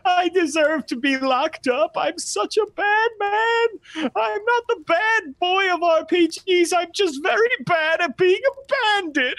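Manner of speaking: very high-pitched comic blubbering; hammed-up crying. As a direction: wail through exaggerated mock sobs. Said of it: "is crying".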